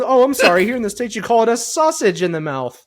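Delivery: a touch of high prim British lady; lightly voiced Mrs Doubtfire, British accent. Tobias doing Mrs Doubtfire to Michael, British accent. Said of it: "Missus Doubtfire stick".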